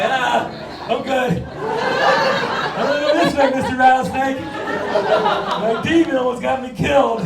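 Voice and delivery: high, loud, slow, laughing through the words; laughing scared reply. Retold or imitated. To the rattlesnake, a loud laughing nervous backing off. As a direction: back off from the snake, half laughing. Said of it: "I'm like".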